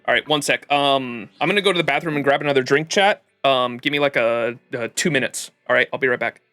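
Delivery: ordinary delivery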